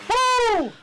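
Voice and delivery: high, loud whoop; loud woo. Real, not acted. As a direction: shout a cheering woo. Cheering the match.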